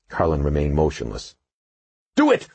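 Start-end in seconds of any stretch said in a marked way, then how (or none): 2.2-2.5 very high, loud sharp command; snapped order. A narrator's reading. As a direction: snap it at him. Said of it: "she snapped".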